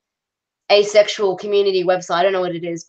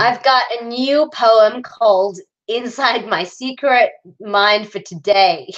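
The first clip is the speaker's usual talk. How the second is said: slow, drawn-out mock American accent; 3 semitones higher, much slower than usual. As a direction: read it in a forced American accent, leaning on the R's. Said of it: "in an American accent".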